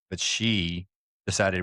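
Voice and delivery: ordinary talk, nothing marked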